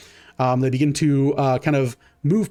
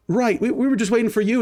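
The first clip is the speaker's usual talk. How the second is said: higher, hurried excuse; nervous private; 9 semitones higher than usual. Acted. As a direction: cover for yourself quickly. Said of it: "He goes".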